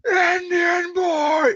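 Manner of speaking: long, high bellow carrying across a field; imitated Waz scream. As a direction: holler it high, dragging out each word. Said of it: "when he screams".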